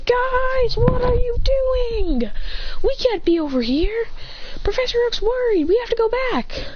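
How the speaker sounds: very high, stretched-out urgent plea; high-pitched acted urgency; urgent calling. A narrator's reading. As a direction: call out to friends up high. Hop runs up, urging them.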